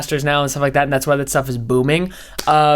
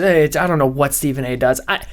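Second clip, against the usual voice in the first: ordinary talk, nothing marked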